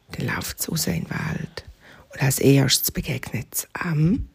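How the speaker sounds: hushed, unvoiced storytelling; soft whispered bedtime telling; whispered narration. A narrator's reading.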